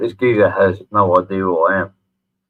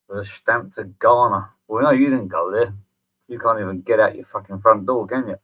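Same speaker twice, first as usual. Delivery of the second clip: normal talking voice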